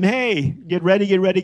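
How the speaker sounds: urging call. Retold or imitated. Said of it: "to tell them".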